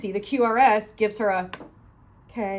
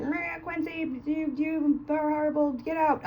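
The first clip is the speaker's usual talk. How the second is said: Pam laying into him; 5 semitones higher, 6 dB quieter, slower than usual. Retold or imitated. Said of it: "She laid into him".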